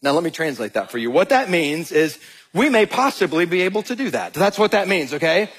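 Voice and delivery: ordinary delivery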